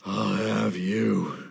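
slow, drawn-out menacing threat; menacing villain. Pretend. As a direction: growl it out slowly like a villain. Mock menacing villain line.

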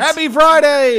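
a high, bright holiday shout; excited cheer. Real, not acted. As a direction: greet everyone with a burst of joy. Cheerful show sign-off.

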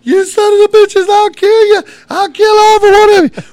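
high, loud enraged screaming; furious retold threats. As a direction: scream threats at your laughing friends, storytelling style. Retold threats at laughing friends.

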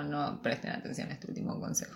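conspiratorial whisper; whispered aside. Acted. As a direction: whisper it behind their backs. Aside hidden from children.

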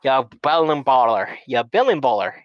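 hammed-up Canadian accent spouting word salad; mock Letterkenny accent. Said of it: "it's the Letterkenny accent".

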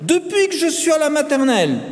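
put-on high, insistent boy; earnest schoolboy protest; with conviction. A narrator's reading. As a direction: insist on it proudly, climbing in pitch. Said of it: "affirma-t-il avec conviction".

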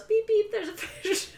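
high, mock robotic fish-finder voice; device beeping voice. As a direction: chirp it like a little gadget. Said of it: "and it goes".

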